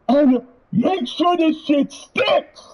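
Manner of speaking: normal talking voice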